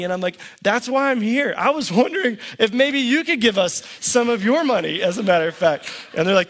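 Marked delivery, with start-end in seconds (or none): 0.6-5.9 much higher, eager pitch; fired up. Retold or imitated. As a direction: pitch the big dream with excitement. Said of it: "I'm all fired up".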